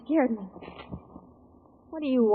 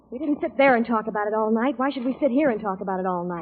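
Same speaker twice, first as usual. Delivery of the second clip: normal talking voice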